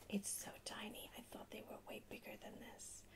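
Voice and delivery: ordinary talk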